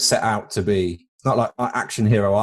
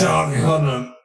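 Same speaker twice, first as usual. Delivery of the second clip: name coughed out, higher pitched; fake cough; 5 semitones higher than usual. Co-host slyly names a bad example, fake cough. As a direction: hide the words inside a mock cough.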